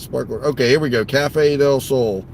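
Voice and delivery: ordinary talk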